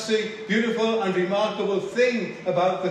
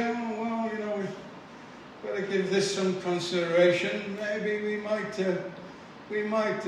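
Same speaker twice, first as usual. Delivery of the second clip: mock hesitant, soft mumbled pondering; imitating a noncommittal, halting reply; dithering; 3 dB quieter than usual. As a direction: put off deciding, with ums and false starts. Voices the hesitant reply never given.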